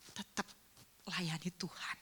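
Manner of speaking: soft unvoiced whisper. Pastor urging the weeping husband, hushed whisper. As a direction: breathe the words gently.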